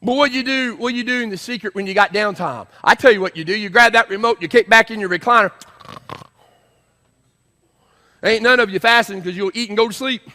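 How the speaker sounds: loud, high pulpit challenge; full-force preaching. Real, not acted. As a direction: call the congregation out loudly. Preaches at the congregation.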